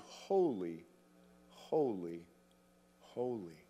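"holy, holy, holy" low and hushed, each word set apart; solemn emphatic repetition. Shown as a demonstration. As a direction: let each "holy" drop and stand alone. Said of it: "Three times for emphasis".

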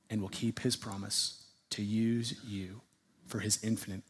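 slow, hushed and low, with weighty pauses; solemn preaching. Real, not acted. Preacher assuring his church that God is faithful, hushed solemn preaching. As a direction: let it sink softly, phrase by phrase. Closes point in solemn hush.

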